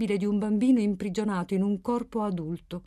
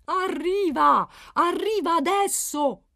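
loud, high, stretched-out insistence; insistenza infantile; 10 semitones higher, 6 dB louder, slower than usual. A narrator's reading. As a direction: insist like a child stamping his feet. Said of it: "insisteva Settimio, battendo i piedi come un bambino". (grido insistente, come un bambino che pesta i piedi)

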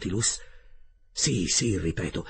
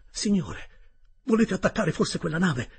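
alarmed protest; 8 semitones higher than usual. Acted. Narrator protests the captain's attack.

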